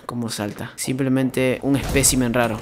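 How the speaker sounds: lightly hammed-up hushed documentary voice; mock documentary narration. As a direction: narrate the creature like a wildlife host. Mock nature documentary commentary.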